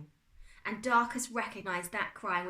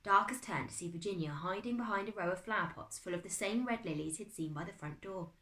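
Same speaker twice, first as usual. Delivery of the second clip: ordinary delivery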